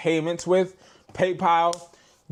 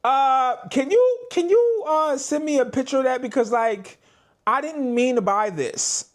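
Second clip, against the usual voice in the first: mock customer; 7 semitones higher than usual. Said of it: "customers like".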